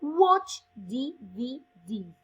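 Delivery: slow, loud "I don't watch D V Ds", each word clear; careful model sentence. Shown as a demonstration. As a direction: spell it out slowly for the class. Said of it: "cuidado con la pronunciación".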